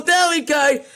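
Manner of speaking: high, loud quoted phrase; angry mocking quote. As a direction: shout the phrase you can't stand. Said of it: "would not say".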